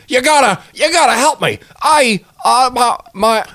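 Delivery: high, loud, frantic damsel voice; high frantic plea; panicked noir victim. Pretend. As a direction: burst in begging for help. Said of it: "me bursting into Bunny's office".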